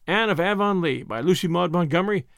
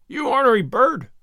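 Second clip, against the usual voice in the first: muttered; 7 semitones higher, 5 dB louder than usual. Acted. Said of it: "he muttered".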